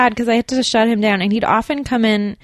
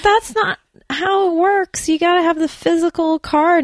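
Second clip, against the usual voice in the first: high incredulous voice; 10 semitones higher than usual. Retold or imitated. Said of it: "I'd be like".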